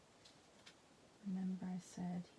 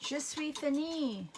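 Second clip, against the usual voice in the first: higher, louder, slow French announcement; playful French; 7 semitones higher, 7 dB louder than usual. Acted. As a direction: sing out "Je suis finie" in French. Announcing she is finished.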